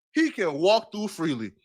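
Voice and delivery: normal talking voice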